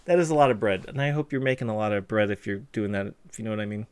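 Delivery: usual speaking voice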